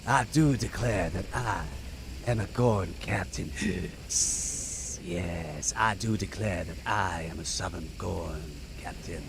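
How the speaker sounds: drawling Southern Gorn captain; Southern Gorn accent. Shown as a demonstration. Streamer announces himself as captain, Southern Gorn accent. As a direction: stretch it out, Southern and reptilian, with gasps. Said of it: "that's my attempt to do a".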